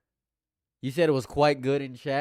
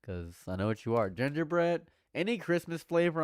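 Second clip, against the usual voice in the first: usual speaking voice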